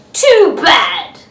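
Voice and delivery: loud, rude retort; grouchy retort. Acted. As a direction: throw it back rudely.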